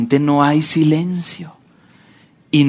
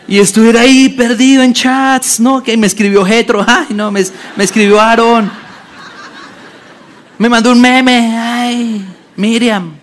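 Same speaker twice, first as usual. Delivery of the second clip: higher, louder scattered chatter; distracted Moses on his phone; 6 semitones higher, 4 dB louder than usual. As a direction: play a man lost in his chats.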